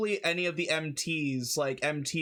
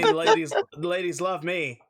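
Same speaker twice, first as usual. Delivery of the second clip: a hint of a show-off; boasting character voice; 6 dB louder than usual. GM brags in character.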